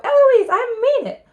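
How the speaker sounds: high, loud mocking yell; piercing copycat shout; shouting back. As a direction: shriek the tutor's warning back at the top of the voice. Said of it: "And then I shout".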